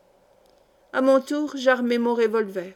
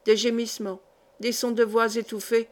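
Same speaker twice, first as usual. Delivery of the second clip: usual speaking voice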